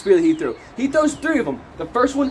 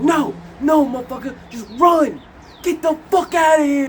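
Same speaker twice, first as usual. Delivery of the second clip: high, frantic mock shouting; acted urgent yell; 7 semitones higher, 3 dB louder than usual. Clarence begs Barabbas to run, acted urgent yell. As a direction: yell for your friend to flee.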